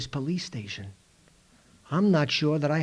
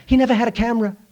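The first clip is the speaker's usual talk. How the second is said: ordinary delivery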